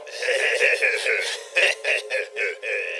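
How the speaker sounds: loud villainous cackle; evil laugh. Acted. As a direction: throw back an evil laugh. Villain laughs after line.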